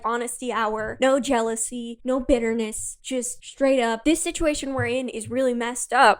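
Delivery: normal talking voice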